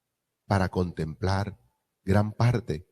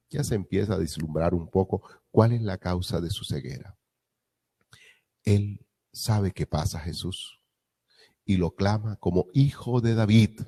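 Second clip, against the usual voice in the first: ordinary talk, nothing marked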